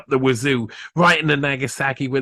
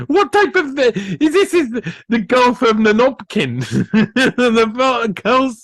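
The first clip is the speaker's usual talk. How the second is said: higher, giggling through the words; high amused giggly delivery; laughing at own joke; 6 semitones higher than usual. Real, not acted. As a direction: crack up at your own pun. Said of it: "That's a good one".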